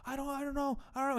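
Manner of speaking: a kid imitated crying out that he doesn't know; imitating sobbing kid. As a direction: whine it like a crying boy. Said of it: "And he just starts sobbing".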